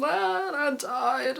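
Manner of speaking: high, slow, dramatic wail of a fan; mock anguish. Fan telling viewers how the finale wrecked her, hammed-up wail. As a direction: ham up being destroyed by it. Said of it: "the second one was".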